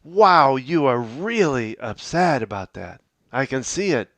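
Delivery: loud, lifted WOW then steady; energetic empathetic reply; matching their energy. Shown as a demonstration. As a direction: burst in on wow, then stay warm. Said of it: "if you match their energy".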